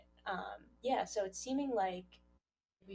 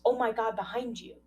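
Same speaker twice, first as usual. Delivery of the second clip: mock scream, higher and louder; imitating a panicked yell of warning; retold scream; 5 semitones higher, 10 dB louder than usual. Podcaster retelling Jonah screaming a warning, mock yell. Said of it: "And then Jonah screams".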